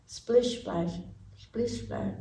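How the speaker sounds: quiet wet footsteps; soft splish splash. Acted. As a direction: make it drip away quietly. Sound effect of frog steps.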